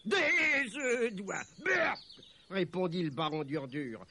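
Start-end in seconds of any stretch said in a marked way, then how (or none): 0.1-2.0 high, loud, drawn-out outburst of disgust; haughty baron's scornful exclamation. Acted. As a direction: spit out the offer with contempt. Baron scorns the offered eggs.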